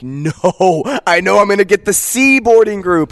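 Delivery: exaggerated retold lament; mock dismay. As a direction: act out realizing it too late. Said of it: "I'm like".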